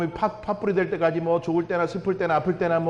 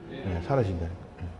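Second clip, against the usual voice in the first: soft insistence; 12 semitones lower, 8 dB quieter than usual. Acted. Preacher insisting softly.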